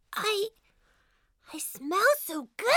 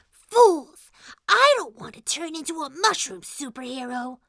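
muttering to himself; 2 semitones higher than usual. Acted. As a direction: grumble it privately, dropping far down in pitch. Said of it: "Little Mushroom was muttering to himself".

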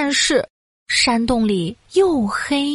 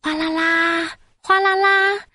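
sing-out splashing noise; rushing water imitation; 4 semitones higher than usual. A narrator's reading. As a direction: mimic water gushing into a cave. Narrator imitates pouring water.

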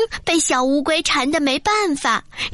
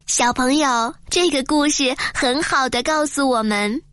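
ordinary delivery, nothing marked

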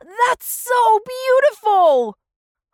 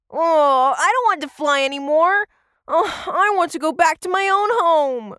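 homesick moaning; 7 semitones higher than usual. Acted. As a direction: whine wearily for your own home. Tommy dreads two months of flying.

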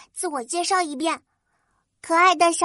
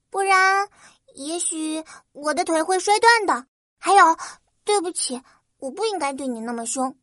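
touched; 4 semitones higher, faster than usual. Acted. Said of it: "花仙子感动极了".

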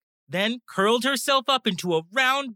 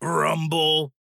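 low rumbling sound word; tummy rumble; 6 semitones lower than usual. A narrator's reading. Narrator imitating a growling tummy, low rumble.

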